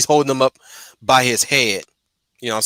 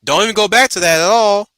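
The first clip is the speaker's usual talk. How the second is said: much higher and louder, almost shouting; near shout; 9 semitones higher, 6 dB louder than usual. Acted. Repeating the rule to the co-host, near shouting. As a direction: blast the rule home. Repeats the line with rising force.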